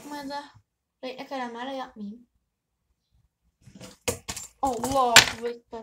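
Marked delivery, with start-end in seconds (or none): 4.6-5.8 loud, slow startled cry; startled exclamation. Real, not acted. Girl startled by something scary, loud slow cry. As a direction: jump at the fright and blurt it out. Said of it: "Çok korkunçtu vallahi".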